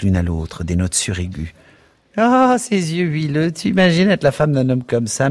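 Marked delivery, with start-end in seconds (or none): 2.2-5.3 mewing mockery. A narrator's reading. Miss Kennedy teasing her friend about Bloom, mewing mockery. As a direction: mew it like a cat, mocking him. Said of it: "miaulait mademoiselle Kennedy".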